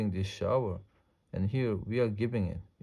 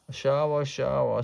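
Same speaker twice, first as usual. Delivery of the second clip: mild storybook scream, slightly raised; narrator's understated mock scream; children screaming; 4 semitones higher than usual. A narrator's reading. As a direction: exclaim it like squealing kids, held back. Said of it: "screamed Missus Ralph's four children".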